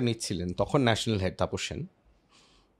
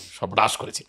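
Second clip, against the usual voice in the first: ordinary talk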